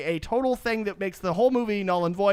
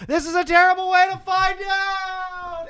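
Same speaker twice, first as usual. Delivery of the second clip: very high, drawn-out comic wail; mock falling wail; 11 semitones higher, 3 dB louder than usual. Gamora as she is thrown off the cliff, mock falling wail. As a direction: wail it high, stretching the last word.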